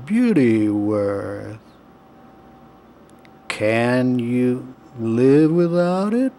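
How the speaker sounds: very slow, lazy sloth voice; deep lazy voice. A narrator's reading. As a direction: drag every word out like a sloth. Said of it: "in her deep and lazy voice".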